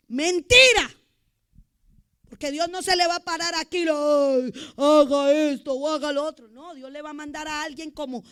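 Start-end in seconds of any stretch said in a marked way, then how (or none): 0.1-3.5 loud, high, forceful rebuttal; shouted denial; emphatic shout. Real, not acted. Preacher refutes the congregation. 3.8-6.5 loud, put-on booming command; hammed-up heavenly order, much louder; mock divine shout.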